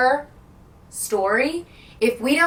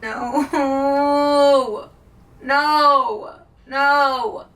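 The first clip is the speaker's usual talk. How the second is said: drawn-out, louder wail of no; wailed dismay; 3 semitones higher, 4 dB louder, much slower than usual. Real, not acted. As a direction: whine it out in dismay. Dreading the end of the book.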